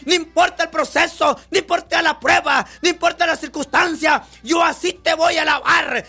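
predicador clamando a Dios con fervor; oración predicada. Acted. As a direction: ruega con fuerza como en oración. Fervent prayer preaching. (ora a voz en cuello ante la congregación)

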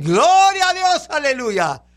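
exclamación de victoria, aguda y alargada; grito de júbilo. Shown as a demonstration. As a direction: grita la alabanza alargando las palabras. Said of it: "No, griten". (grito de victoria, agudo y sostenido)

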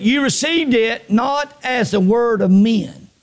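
slow, emphatic scripture reading; measured preacher's reading. Real, not acted. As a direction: read the verse deliberately, word by word. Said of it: "I'm gonna read it slow".

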